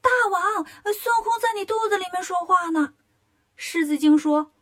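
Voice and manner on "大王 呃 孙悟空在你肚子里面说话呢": panicked, higher cry of fright; little demons shrieking in alarm; frightened. A narrator's reading. Little demons alert their king, frightened and higher. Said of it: "小妖们听见都吓坏了".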